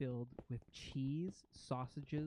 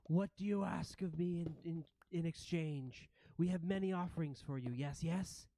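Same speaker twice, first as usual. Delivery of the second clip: higher, fawning creature voice; rat prince; 3 semitones higher, 3 dB louder than usual. Acted. Rat prince offering gifts to the ghosts, eager raised voice. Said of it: "the rat prince comes forward".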